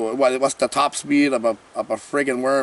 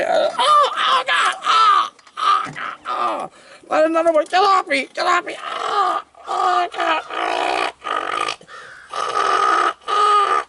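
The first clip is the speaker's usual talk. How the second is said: shrieking, high and panicky, fighting off worms; hammed-up screaming for help; mock terrified screams. Reviewer acting out a worm attack, shrieking in hammed-up terror.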